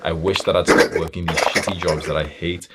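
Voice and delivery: usual speaking voice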